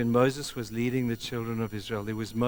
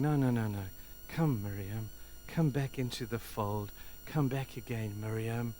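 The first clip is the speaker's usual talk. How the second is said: soft, gentle calling back; tender plea; 2 semitones lower, 7 dB quieter than usual. Acted. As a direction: coax her home gently. Moses pleading for Miriam.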